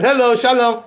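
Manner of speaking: acted cheery doorstep hail; calling out. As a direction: call in to whoever is home. Rabbis calling at a door.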